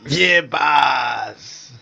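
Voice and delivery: tough mob henchman voice; mafioso voice. Acted. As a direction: play it like a mafia goon. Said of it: "It's gonna be a mafioso boy".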